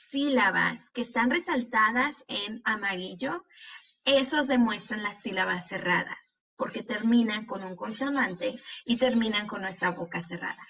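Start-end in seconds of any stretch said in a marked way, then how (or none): none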